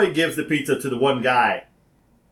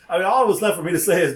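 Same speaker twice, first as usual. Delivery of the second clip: normal talking voice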